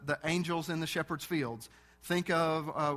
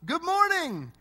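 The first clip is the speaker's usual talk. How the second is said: high, loud called-out good morning; yelled greeting; 12 semitones higher, 6 dB louder, slower than usual. Retold or imitated. A stranger calling out a greeting, shouted. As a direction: call it out across the store. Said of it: "I hear a man yell out".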